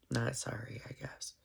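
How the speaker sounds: whispered aside to herself. Real, not acted. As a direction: drop to a breathy whisper. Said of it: "Why am I apologizing?".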